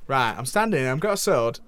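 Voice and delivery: host playing a cheeky northern English voice actor; lightly put-on northern English accent, chatty and blunt; hammy northern English character. Host plays Nolan from North.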